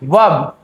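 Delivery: low, isolated वर्ब said as vab; silent R. Shown as a demonstration. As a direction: drop the R entirely in वर्ब. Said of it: "आर बिल्कुल silent है".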